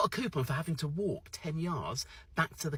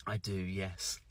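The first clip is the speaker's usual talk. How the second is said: low, soft, prim British reply; more British; 6 semitones lower, 6 dB quieter, much slower than usual. Retold or imitated. Brit getting awkwardly proper with the server, low and soft. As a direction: answer politely, very English. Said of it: "I actually went even more British".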